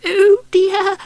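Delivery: frightened mouse voice; squeaky mouse. Acted. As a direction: squeak it like a scared little mouse.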